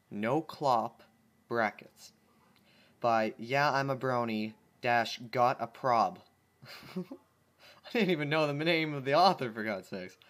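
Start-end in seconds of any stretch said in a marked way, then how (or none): none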